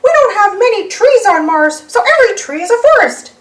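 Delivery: high alien mouse voice; high and chatty, speeding up; Martian Mickey. Acted. Martian Mickey joking to the gang, high and chatty. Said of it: "he says".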